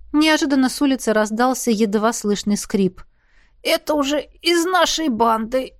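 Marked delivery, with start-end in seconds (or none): 3.6-5.7 higher-pitched moan of a battered elf; pained, higher character voice; groaning. A narrator's reading. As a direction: groan it out as if hurt. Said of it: "простонал подбитый эльф".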